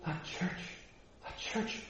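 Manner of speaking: scandalized whisper, very soft; gossip's hushed, shocked muttering; hushed mock horror. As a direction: drop to an appalled near-whisper. Imagined gossip about the church.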